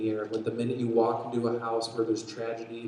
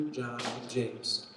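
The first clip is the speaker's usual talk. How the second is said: usual speaking voice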